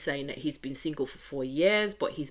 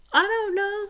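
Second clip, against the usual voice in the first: sing-song shrug; 9 dB louder than usual. Real, not acted. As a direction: toss it up high like a musical shrug. Musing about Joey.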